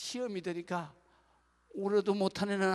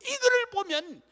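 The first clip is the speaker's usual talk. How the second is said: loud, high preaching to the congregation; full-force preaching; 11 semitones higher, 12 dB louder than usual. Acted. Pastor preaching loudly to the congregation, impassioned.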